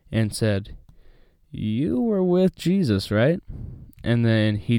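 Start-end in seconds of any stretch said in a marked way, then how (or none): 1.5-3.4 put-on high, slow girlish accusing voice, pitched well up; servant girl voice.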